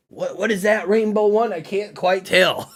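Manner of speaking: high mocking Southern voice, slowing at the end; hammed-up Southern yokel who can't tell cards apart; generic Southern accent. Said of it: "That was my generic Southern accent".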